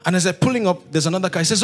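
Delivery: normal talking voice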